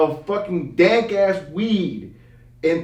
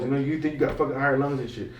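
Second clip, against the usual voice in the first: normal talking voice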